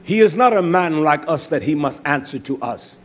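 ordinary talk, nothing marked

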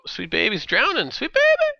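high falsetto squeal of panic; mock alarm. Gamer spots the sweet baby drowning, mock-panicked.

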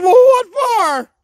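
high, loud, slow dumb question; Puddin's voice. Retold or imitated. Puddin asks the doctor, high baffled squeak. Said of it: "He said".

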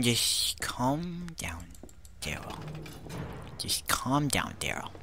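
soft, slow coaxing, trailing into a whisper; hushed soothing. Pretend. Player soothes Daryl after yelling, hushed coaxing. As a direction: talk them down very quietly.

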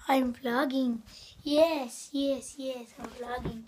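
ordinary delivery

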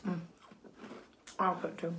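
talking with food in mouth; chewing a biscuit. Real, not acted. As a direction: mouth partly full while speaking. Seen 0: Left speaker holds a biscuit and chews between bites while talking.

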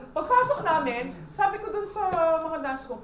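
usual speaking voice

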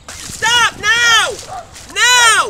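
put-on loud high scream at the kids; full-voiced angry yell. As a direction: bellow stop now. Yelling at splashing kids.

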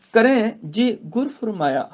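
slow measured Gurbani recitation. Shown as a demonstration. Teacher recites the verse to students, slow. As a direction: chant each word with pauses.

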